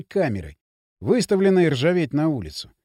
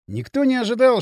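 ordinary delivery, nothing marked

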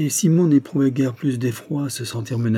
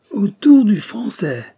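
loud, slow, higher cry; gleeful shout; 10 semitones higher, 7 dB louder, slower than usual. A narrator's reading. The despot calls for the Frenchman, loud gleeful shout. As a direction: shout it out, bursting with cruel glee. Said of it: "s'écria le despote en éclatant de rire".

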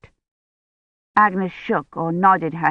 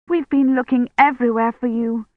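raised pitch, relieved scolding; angry with relief; 6 semitones higher than usual. A narrator's reading. Woman hugs the found child, angry with relief. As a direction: scold the child you were worried about. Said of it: "angry with relief".

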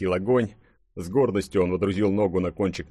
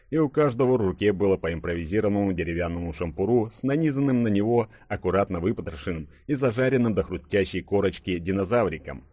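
plain reading voice

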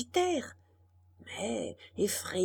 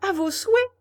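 high, loud little Pinocchio; perky high-pitched call; puppet boy voice; 9 semitones higher, 8 dB louder, faster than usual. A narrator's reading. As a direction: pipe up politely in a high puppet voice.

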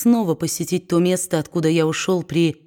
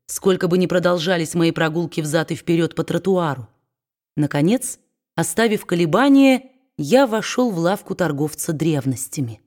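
usual speaking voice

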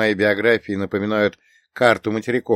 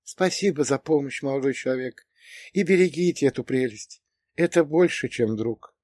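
растроганная старушка; 6 semitones higher than usual. A narrator's reading. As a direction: thank him gently, pitched high and fragile. Tearful old woman thanks and says goodbye. (тихий высокий голос растроганной старушки)

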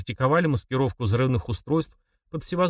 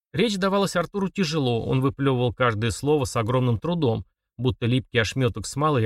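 ordinary delivery

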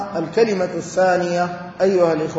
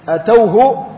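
usual speaking voice

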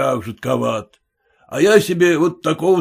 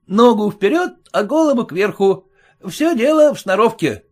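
higher, eager servant voice; a servant selling himself to the merchant; self-important lackey, higher; boastful lackey; 9 semitones higher than usual. A narrator's reading. Lackey describes his looks.

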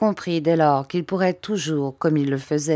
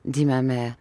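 plain reading voice

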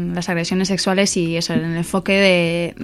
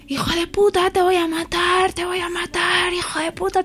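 much higher furious mock yell; retold furious shouting, much higher; imitating an angry yell; 11 semitones higher than usual. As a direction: play the raging stranger shouting threats. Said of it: "tío gritando".

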